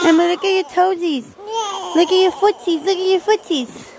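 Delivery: high sing-song coo about toesies. Real, not acted. Mother distracting fussing baby with her feet, high coo.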